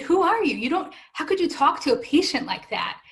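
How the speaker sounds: high, loud, affronted challenge; retold outrage, pitch jumping up; indignant retort. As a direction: fire it back as an outraged patient. Acted retort to an insulting doctor.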